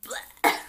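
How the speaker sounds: loud voiceless retching noise; disgusted bleh. Real, not acted. Reacting to their airport hug, mock disgust. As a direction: blurt out a mock gag.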